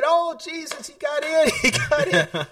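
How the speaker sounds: high, excited grandma voice; grandma praising the Lord, high and laughing; grandma rejoicing. Retold or imitated. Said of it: "And she said".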